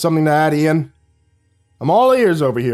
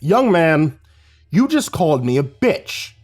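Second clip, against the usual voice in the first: louder, higher stern man; thundering; 5 semitones higher, 3 dB louder, slower than usual. Acted. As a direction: thunder the reproach. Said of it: "Mister Delvin thundered".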